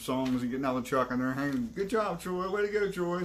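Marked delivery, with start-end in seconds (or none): 1.7-3.2 high-pitched teammates' congratulations; imitated upbeat praise; mock cheering. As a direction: cheer him on in a higher voice. Teammates cheering him at hilltop.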